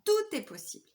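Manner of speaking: bright upbeat refrain on Tout; sing-song mantra. Retold or imitated. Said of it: "C'est ce que je dis à mon chéri tout le temps".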